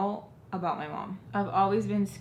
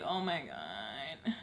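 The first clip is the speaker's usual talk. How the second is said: worried; 5 semitones lower, 7 dB quieter, much slower than usual. Retold or imitated. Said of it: "could see the worry on my face".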